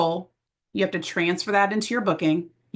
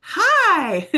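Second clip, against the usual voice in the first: a loud, much higher, beaming hi; bubbly hi; 11 semitones higher, 4 dB louder than usual. Pretend. Loosened-up consultant greeting a client, loud and bubbly. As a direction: greet them like you're thrilled. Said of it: "loosen up and be like".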